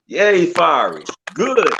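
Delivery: normal talking voice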